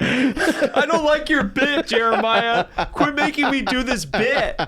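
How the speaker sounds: hammed-up yell at a friend; mock yelling. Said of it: "I'm yelling at you".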